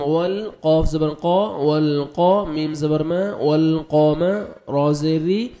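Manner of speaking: ordinary delivery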